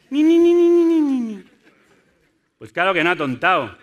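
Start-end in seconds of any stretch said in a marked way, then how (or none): 0.1-1.4 soniquete agudísimo y fuerte; búrlate con una vocecilla chillona y repetitiva; remedo burlón. Retold or imitated. Remeda con burla al provocador. (burla infantil en falsete)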